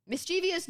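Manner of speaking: "Mischievous" as mis-CHEE-vee-us; common mispronunciation. Shown as a demonstration. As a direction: say "Mischievous" with an extra ee, four syllables. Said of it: "However, it's not the word".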